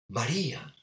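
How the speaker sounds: a gentle, caressing María; tender, loving call. Shown as a demonstration. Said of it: "Con ese cariño".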